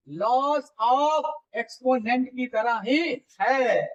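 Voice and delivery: drawn out slowly, word by word, rising at the end; slow emphatic repeat. Real, not acted. Teacher repeating the rule slowly for students, drawn out. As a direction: stretch every word so students catch it.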